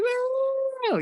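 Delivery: very high drawn-out woo; drunk party woo. Retold or imitated. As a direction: squeal it like a drunk bachelorette.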